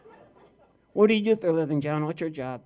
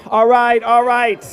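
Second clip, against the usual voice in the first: much louder, higher cut-in; loud protest; 6 semitones higher, 13 dB louder, slower than usual. Acted. Ventriloquist cutting off his dummy's wisecrack, loud protest. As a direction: raise your voice to shut it down. Cutting over audience reaction.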